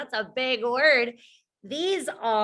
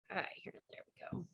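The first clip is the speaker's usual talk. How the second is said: whispered aside; 4 semitones lower, 20 dB quieter, much faster than usual. Real, not acted.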